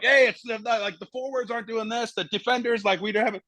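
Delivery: high, protesting players' whine; complaining players. Retold or imitated. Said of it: "everyone's like".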